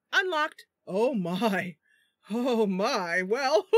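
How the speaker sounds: loud flustered "oh my" bursting into high laughter; excited, giggly. Real, not acted. Streamer reacting to the unlocked room, excited and giggly. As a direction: get carried away, ending in a loud giggle. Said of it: "got a little excited".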